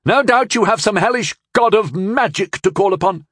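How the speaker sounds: high, sneering jibe; derisive. Acted. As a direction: sneer it at him. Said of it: "with a derisive toss".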